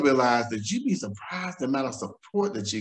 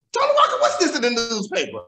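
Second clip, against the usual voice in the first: friend's alarmed call; 11 semitones higher than usual. Retold or imitated. Friend calls at dawn about the article, high and laughing. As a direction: voice her calling in shock, half laughing.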